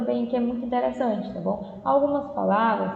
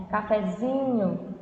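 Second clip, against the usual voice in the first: cafezinho with a long-held ZI; slow demo of cafezinho, stressed near the end; prolonged ZINHO; much slower than usual. Shown as a demonstration. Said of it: "você se prolonga mais".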